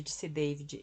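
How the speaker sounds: plain reading voice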